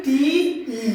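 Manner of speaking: টি broken into ট then a separate ই; split letter sound. Shown as a demonstration. Teacher splitting টি into ট and ই for students. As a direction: say টি, pause, then ই on its own. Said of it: "তাহলে টি মানে কি?".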